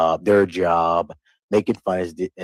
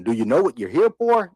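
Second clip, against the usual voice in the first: condescending; 7 semitones higher, 3 dB louder than usual. Retold or imitated. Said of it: "That's condescending".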